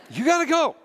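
high-pitched, emphatic imitated order; insistent dismissal. Wife sending husband off to church, acted insistent command. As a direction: push it up high and forceful.

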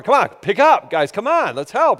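insistent dad call; a little stronger. Retold or imitated. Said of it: "so I say it a little stronger".

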